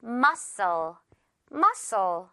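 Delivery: "Muscle" as MUH-sul, the c silent; "Muscle" in two careful syllables, dropping on the second; correct pronunciation. Shown as a demonstration. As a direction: model "Muscle" slowly with no k sound. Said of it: "이렇게 읽으면 됩니다".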